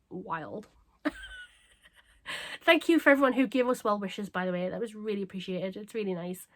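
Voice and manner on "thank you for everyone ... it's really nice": usual speaking voice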